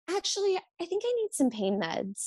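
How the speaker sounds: high, whiny patient voice up top; mock needy patient. Nurse mimicking a needy patient, high and whiny. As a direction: play the demanding patient asking sweetly.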